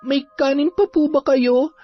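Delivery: put-on very high girlish voice; high-pitched, respectful daughter figure; polite young girl. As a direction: greet an elder as a polite child. Young girl answers shyly.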